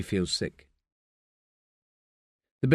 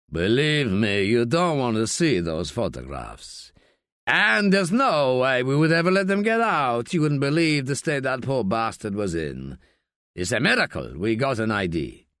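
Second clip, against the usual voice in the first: gruff coroner Piquet, dismissive. Acted. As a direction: play a fat, curt Spanish coroner.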